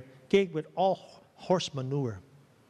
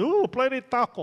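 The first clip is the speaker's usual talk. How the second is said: excited; 7 semitones higher, 4 dB louder than usual. Retold or imitated. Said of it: "He goes".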